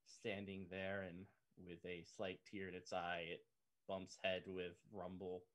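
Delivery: hushed, solemn storytelling; soft sad narration. Acted. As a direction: narrate the farewell gently, almost whispering. GM narrates a sad death scene.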